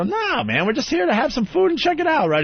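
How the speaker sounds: high, casual brush-off; playing innocent. Guest reassuring a curious security guy, high-pitched innocence. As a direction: wave it away in a light, high voice. Acted innocent reply to the guard.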